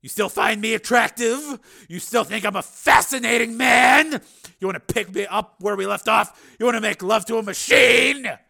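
furious taunting. Acted. Steele taunting Susan as he grips her, furious. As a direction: fire each question at her, building.

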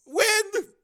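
a high, loud crack on the word. Acted. Engineer asking the senator, voice cracking. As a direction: let the voice break on it. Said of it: "his voice cracked".

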